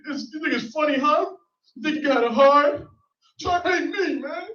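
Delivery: high-pitched, whining fake tears; hammed-up tearful complaint; mock crying. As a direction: imitate a man starting to cry mid-argument. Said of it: "He starts, like, crying".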